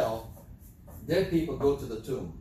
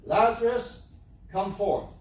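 loud, higher call into the tomb; 5 semitones higher, 5 dB louder, slower than usual. A narrator's reading. Jesus calling Lazarus out of the tomb, loud. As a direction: call him out with full voice. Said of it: "The Bible says with a loud voice".

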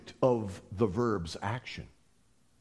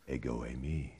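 low solemn; 13 semitones lower, 8 dB quieter than usual. Acted. Jesus' answer, voiced.

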